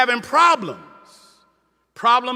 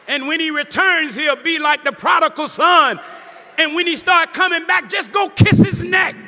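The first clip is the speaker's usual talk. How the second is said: rising preacher's cry, half sung at the end; preaching at full force; 5 semitones higher than usual. Acted. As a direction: build it up and lilt the last words. Preaching to congregation.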